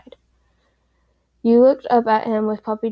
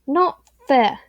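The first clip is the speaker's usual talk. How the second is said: exclaimed; 4 semitones higher, 4 dB louder than usual. A narrator's reading. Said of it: "you exclaimed".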